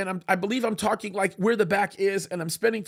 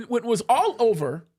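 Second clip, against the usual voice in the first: usual speaking voice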